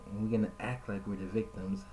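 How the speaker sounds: ordinary delivery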